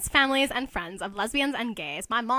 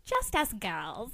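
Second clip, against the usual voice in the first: camp tease; 7 dB quieter, slower than usual. Acted. Nelson batting eyelashes.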